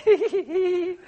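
a hammed-up high schoolgirl titter; schoolgirl giggle. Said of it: "She's giggling like a schoolgirl".

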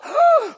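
high mock gasp of horror; hammed-up outraged gasp; shocked gasp. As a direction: gasp as if scandalized. Official reacts to healing.

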